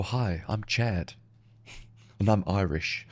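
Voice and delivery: lightly mocked American, low and soft; slow, low introduction as an American; imitated American. Comic mocking Americans who claim Irish roots, imitated American. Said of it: "annoys me most about Americans, though, is when they go".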